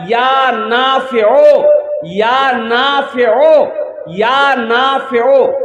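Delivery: या नाफिओ drawn out slowly, each word long; slow recitation. Shown as a demonstration. Preacher teaching the gathering the wazifa, slow and drawn out. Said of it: "वो वजीफा है".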